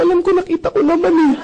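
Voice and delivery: a hammed-up irritated reply; mock exasperated retort. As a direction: snap back in your head, half joking. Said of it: "sa isip ko lang".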